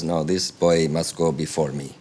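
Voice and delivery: imitated Budapest old lady; Budapest accent. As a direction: put on a measured Hungarian accent. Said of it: "That's my Budapest accent".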